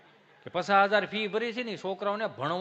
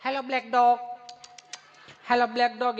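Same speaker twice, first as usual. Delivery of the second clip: louder coaxing call to the dog; mock coaxing call; 3 semitones higher, 5 dB louder than usual. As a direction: call the dog politely in English. Woman calls the dog louder.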